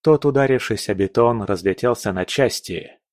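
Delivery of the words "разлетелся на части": plain reading voice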